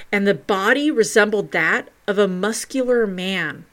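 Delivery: usual speaking voice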